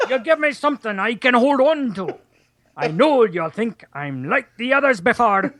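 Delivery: Scottish accent. Shown as a demonstration. Host trying song lyrics in Scottish, comic Scottish accent. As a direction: give the lyrics a playful Scottish lilt. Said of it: "do it with a Scottish accent".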